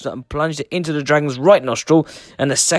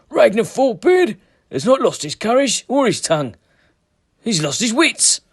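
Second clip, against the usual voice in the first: Sven's voice; 10 semitones higher than usual. A narrator's reading. Sven voiced in the saga.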